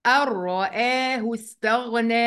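slow "أن رآه استغنى" as ar-ra-aahu staghna; nun merged into ra. Shown as a demonstration. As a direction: roll straight into a doubled r in "أن رآه". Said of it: "هنلغي النون".

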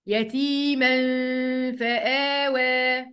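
"يتيما" held in a nasal hum before "فآوى"; ikhfa with ghunna. Shown as a demonstration. Teacher showing students how to say it, "يتيما" with held ghunna. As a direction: chant "يتيما فآوى", hiding the nun in a hum. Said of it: "يلا ننطقها تاني".

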